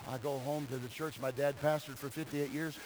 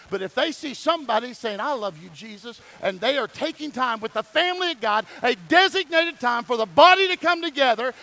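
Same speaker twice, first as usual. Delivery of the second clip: rising, louder and higher to a shout; preaching at full force; 6 semitones higher, 10 dB louder than usual. Acted. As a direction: build the sermon to a shouted peak. Preaching climax again.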